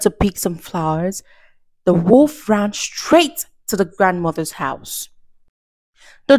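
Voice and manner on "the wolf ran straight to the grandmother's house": ordinary delivery, nothing marked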